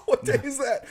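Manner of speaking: high-pitched, laughing through the question. Real, not acted. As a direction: ask it while cracking up. Cracking up at holiday name.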